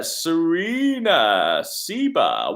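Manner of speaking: slow, swooping announcement of a name; drawn-out name call. Real, not acted. Host calls out the next questioner, drawn out. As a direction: stretch the name out with a big swoop.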